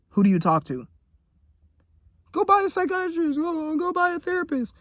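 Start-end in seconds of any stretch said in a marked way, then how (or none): 2.3-4.7 much higher, nasal-free sneering mock voice; mocking commenter. Viewers telling him to get therapy, mocked in a high voice. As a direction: parrot the know-it-all advice in a silly high voice.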